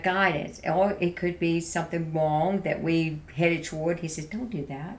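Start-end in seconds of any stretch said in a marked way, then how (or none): 4.3-5.0 soft, loving warning; gentle loving voice. Retold or imitated. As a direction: gently say don't, with love. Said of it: "In a loving way".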